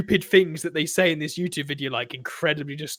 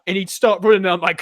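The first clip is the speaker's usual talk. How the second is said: usual speaking voice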